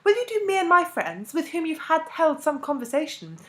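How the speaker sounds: high, loud, ceremonious address; elaborately courteous invitation. A narrator's reading. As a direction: deliver a florid, formal invitation to a foreign dignitary. Formal invitation in supposed Spanish.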